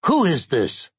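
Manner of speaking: high, insistent question; insistent high-voiced caller; demanding. A narrator's reading. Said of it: "the caller demanded".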